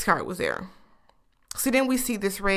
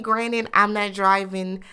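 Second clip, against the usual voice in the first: ordinary talk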